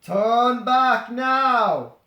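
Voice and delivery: slow, loud and drawn out; slow looming shout; booming warning. Acted. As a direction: warn them off like a doom sign. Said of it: "another long sign".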